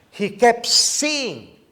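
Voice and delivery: slow it down and ring it out; preaching climax. Acted.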